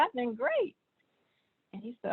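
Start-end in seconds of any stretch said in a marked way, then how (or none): 0.0-0.7 chirpy retold answer, pitched way up; upbeat reply. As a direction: answer the doctor in a high, breezy lilt. Retells her cheerful reply to doctor.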